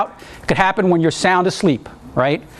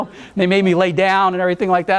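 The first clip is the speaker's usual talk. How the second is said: ordinary delivery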